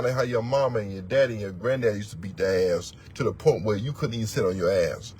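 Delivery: normal talking voice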